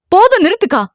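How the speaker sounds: high, sharp yell to stop her; furious shout. A narrator's reading. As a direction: cut her sister off with a shout. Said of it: "ஆக்ரோஷமாக கத்தினாள்". (ஆக்ரோஷமான கத்தல்)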